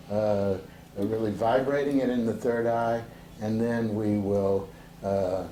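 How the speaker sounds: normal talking voice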